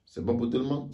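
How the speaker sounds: ordinary talk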